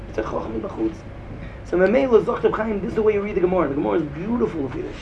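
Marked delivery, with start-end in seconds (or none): 0.1-0.9 choch mi bachutz repeated low and soft; quiet echo of the point. Shown as a demonstration. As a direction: drop the voice and echo it to yourself.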